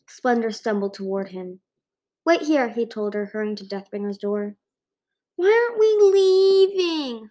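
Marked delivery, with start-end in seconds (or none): 2.3-2.7 urgent. A narrator's reading. Urgent instruction in escape. 5.4-7.3 high, loud, slow wailing dragon voice. A narrator's reading. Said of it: "Splendor wailed".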